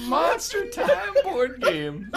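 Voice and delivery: helpless giggling; laughing hard. Real, not acted. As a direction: wheeze the words out while giggling. Cracking up repeating silly answer.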